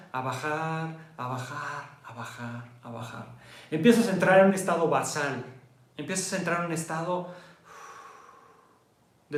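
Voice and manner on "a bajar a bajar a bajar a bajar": softer and lower each time; fading down. Shown as a demonstration. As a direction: let it sink away. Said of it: "muestra cómo baja". (cada vez más bajo)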